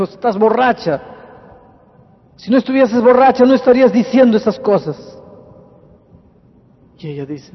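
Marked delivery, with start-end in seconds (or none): 0.0-5.1 acted loud, high rebuke; angry accusation. Husband telling his wife she must be drunk, angry accusation. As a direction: hurl the accusation, raised voice throughout.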